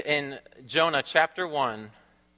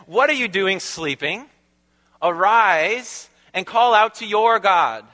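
louder, higher, slow command to wake up; captain shouting; 5 semitones higher, 5 dB louder, slower than usual. Retold or imitated. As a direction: bark the captain's order down at Jonah. Captain voiced waking Jonah.

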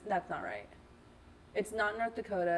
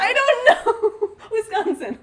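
much higher and louder, laughing, slow on the first words; nervous laugh; 13 semitones higher, 13 dB louder, slower than usual. Real, not acted. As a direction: blurt it out half-laughing and unsure. Nervous final answer, laughing.

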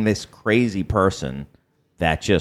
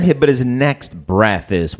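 usual speaking voice

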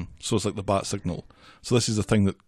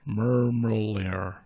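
slow, stretched American "Mermalayer"; American accent; 3 dB quieter, much slower than usual. Shown as a demonstration. Co-host attempting the American accent on request, very slow American imitation. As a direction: copy an American accent, drawing the word out. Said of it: "your American accent".

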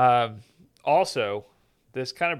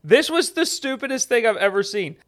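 exasperated rant; 8 semitones higher, 7 dB louder than usual. Real, not acted. Ranting about recession definition.

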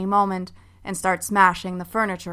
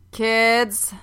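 mom voice; 3 semitones higher than usual. Acted. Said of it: "My mom voice is on like a switch".

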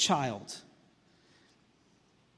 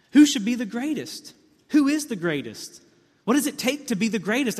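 loud, higher, rising sermon questions; forceful preaching; 7 semitones higher, 5 dB louder than usual. Acted. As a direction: hammer each question at the congregation.

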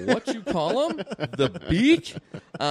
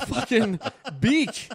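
ordinary delivery

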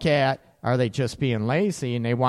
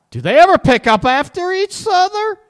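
high, loud shocked realisation; shocked disbelief; 8 dB louder than usual. Retold or imitated. Partner realising their spouse never tidies, shocked and loud. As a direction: blurt it out in sudden disbelief. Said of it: "all of a sudden you realize".

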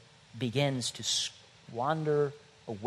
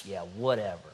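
sulky dismissive; 6 semitones lower, 4 dB quieter than usual. Acted. Churchgoer sulking mockingly.

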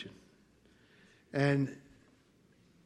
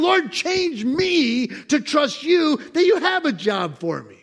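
higher, louder pleading prayer; loud voiced prayer; 10 semitones higher, 7 dB louder, slower than usual. Preacher voicing a believer's prayer, loud plea. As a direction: pray it out, high and urgent.

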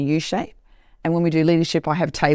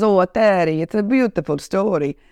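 imitated Italian restaurant owner; fond mock Italian-accented voice; 3 semitones higher than usual. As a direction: put on an Italian storyteller's accent. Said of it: "and I do accents".

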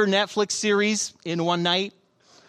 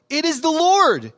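high, raised cry of recognition; exclaiming; 8 semitones higher, 4 dB louder than usual. A narrator's reading. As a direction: exclaim it as a sudden discovery. Disciple recognizes Jesus, exclaims.

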